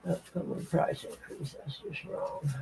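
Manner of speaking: whispered aside to self; whispering. Real, not acted. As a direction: breathe it, barely voiced. Reading to self.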